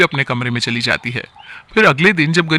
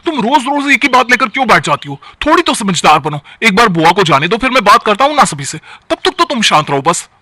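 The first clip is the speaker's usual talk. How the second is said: a bit louder, exasperated pleading; irritated husband; 5 semitones higher, 4 dB louder than usual. Acted. Rajeev to Garima: mildly raised, fed-up reasoning. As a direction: tell her firmly to be patient.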